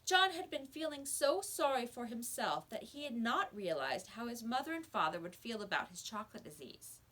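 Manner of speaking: usual speaking voice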